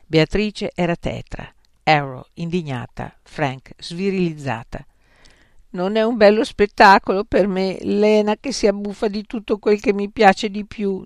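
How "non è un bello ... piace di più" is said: whining, hard-done-by complaint; plaintive. A narrator's reading. Beatrice complaining about Lena's feasting, plaintive. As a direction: moan it like a wronged friend. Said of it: "in tono lamentoso".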